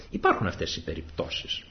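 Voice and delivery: ordinary talk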